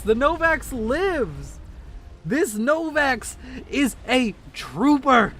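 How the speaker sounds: high, slow triumphant commentary; excited cheer. Real, not acted. Game caster hails the surviving Novax, excited cheer.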